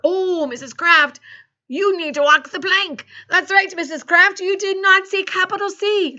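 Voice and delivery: high, loud telling-off; mock pirate scolding. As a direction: scold like an outraged pirate captain. Acted pirate scolding the teacher.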